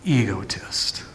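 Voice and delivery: high, loud, mocking scorn; accusing inner voice mocking the would-be Messiah; sneering accuser. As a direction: jeer at him with contempt. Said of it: "the accuser voice".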